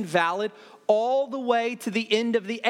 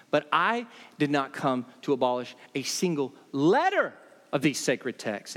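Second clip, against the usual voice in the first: ordinary delivery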